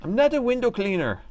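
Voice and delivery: high put-on Irish accent attempt; bad Irish accent. Streamer attempting the Irish character's accent, high and put-on. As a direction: try the Irish accent and miss it. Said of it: "I can't do his accent".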